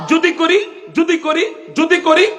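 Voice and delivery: high rising questions, repeated; rising repeated plea. Acted. Preacher warning listeners about disrespecting parents, high rising repetition. Preacher building rhetorical tension.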